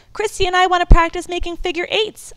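put-on high, loud excited girl; eager child. A narrator's reading. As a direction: blurt it out eagerly. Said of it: "I added".

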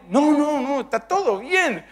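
high, drawn-out all-clear; mock emphatic reassurance. Husband telling wife he is fine, exaggerated reassurance. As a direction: sing out the good news, stretched and high. Acted husband announces good news.

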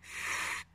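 ف as a soft, sustained voiceless hiss of air; quiet drawn-out ف alone; isolated letter sound. Shown as a demonstration. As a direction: hold just the sound of ف, no vowel. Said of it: "أصله إلى حرف الفاء".

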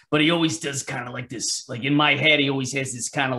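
usual speaking voice

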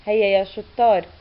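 usual speaking voice

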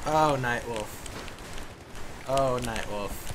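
slow drawn-out mock lament; mock mourning. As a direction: pretend to grieve Night Wolf. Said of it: "mock mourning".

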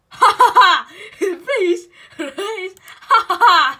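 loud cackling laughter with a pleading please; real laughing. Real, not acted. Laughing at the computer while pleading with it. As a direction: laugh hard and beg between laughs.

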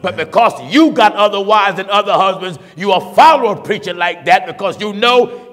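fiery preacher denunciation; preaching at full force. Acted. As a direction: preach hard against the sinners.

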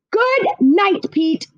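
firm, final good night with no room for argument; mother shutting down the squabble with finality; great finality. A narrator's reading. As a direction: end the discussion, mother's last word. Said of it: "said with great finality".

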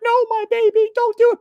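very high, loud mock-mother wail; hammed-up shrieking mom protest; panicked mom. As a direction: imitate a pleading mother in squeaky falsetto. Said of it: "And the mom's like".

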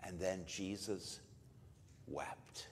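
slow hushed whisper. Real, not acted. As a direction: whisper it slowly, drawn out and breathless. Retelling Jesus weeping, dropping to whisper.

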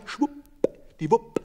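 high, slow, drawn-out schwuppdiwupp; playful high schwuppdiwupp; sing-song schwuppdiwupp. A narrator's reading. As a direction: swoop the word up high and stretch it. Hens hoisted up on line.

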